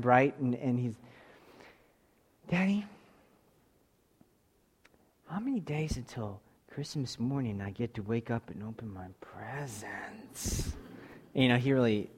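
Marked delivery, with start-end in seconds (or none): 2.5-10.8 put-on hushed bedtime child. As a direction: hush it like a sleepy boy in bed. Sleepy son asks about Christmas.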